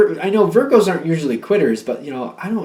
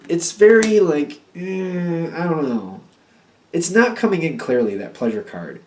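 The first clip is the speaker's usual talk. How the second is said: ordinary talk, nothing marked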